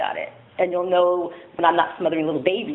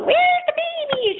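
put-on much higher, slow, thrilled to see the baby; excited; slower than usual. Church lady at the door asking for the baby, thrilled and high. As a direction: squeal it with delight, drawn out. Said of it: "She's so excited to see the baby".

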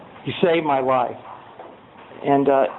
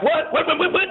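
mock alarmed questions; 10 semitones higher than usual. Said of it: "I'm like".

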